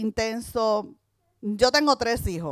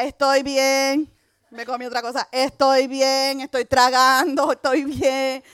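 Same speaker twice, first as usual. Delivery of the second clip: "Estoy bien" lento, más alto y fuerte, tranquilizando a todos; repítelo despacio y con fuerza: estoy bien; insistiendo animada; 5 semitones higher, 6 dB louder, slower than usual. Retold or imitated. Todos la miran esperando que muera. (mujer picada asegura a todos que está bien, lenta y enfática)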